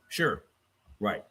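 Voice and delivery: ordinary talk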